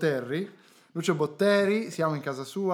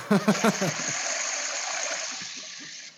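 breathy laugh; 5 semitones higher than usual. Real, not acted. Laughing at the toilet reveal.

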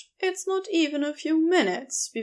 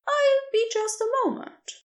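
higher, lighter voice for the kind stranger; gentle rescuer voice; 12 semitones higher than usual. Acted. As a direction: offer your name brightly, a little quicker. Narrator voices Aziraphale.

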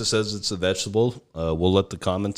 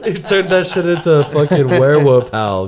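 laughing; 6 semitones higher, 6 dB louder than usual. Real, not acted. Laughing through the remark.

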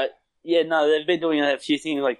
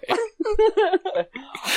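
squealing high laugh; real squeal of laughter. Real, not acted. Laughing at the quote.

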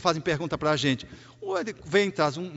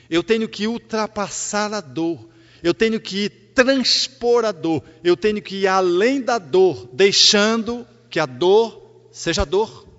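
louder, slow emphatic sermon lines; forceful preaching; 6 dB louder, slower than usual. Acted. Spiritist speaker urging listeners to face pain, loud and slow. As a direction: drive each phrase home from the pulpit.

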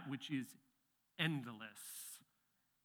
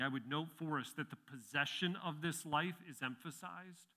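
usual speaking voice

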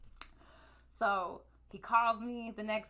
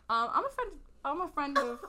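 higher, louder, overly polite caller; sweet phone voice; 5 semitones higher, 4 dB louder than usual. Retold or imitated. Calling the guy's mom pretending to be a friend, fake-sweet voice. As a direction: put on a sweet innocent phone voice.

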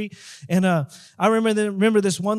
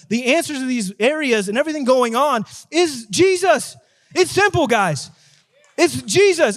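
high, driving preacher delivery building in force; impassioned preaching; 5 semitones higher, 3 dB louder than usual. Real, not acted. Preacher calling ordinary people to say yes, impassioned preaching. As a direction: drive each phrase home to the congregation.

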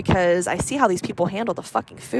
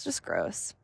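softer trailing repeat; quiet echo; 13 semitones lower, 13 dB quieter than usual. Real, not acted. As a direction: let it fall away under the breath. Repeats the phrase, fading.

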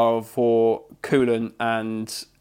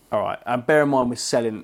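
ordinary delivery

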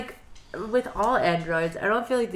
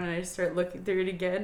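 ordinary talk

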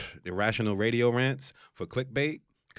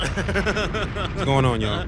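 high, slow, loud greeting; drawn-out hype greeting; 10 semitones higher, 9 dB louder, much slower than usual. Acted. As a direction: stretch out the hello to the crowd. Host greets listeners, drawn out.

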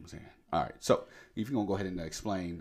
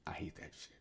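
whispered under the breath; whispered aside; 14 dB quieter than usual. Real, not acted. Muttered aside before laughing.

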